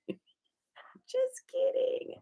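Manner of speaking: high, slow, whispery aside; playful stage whisper; hushed aside. Real, not acted. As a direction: whisper it softly as a joke, drawn out. Joking aside after a wink.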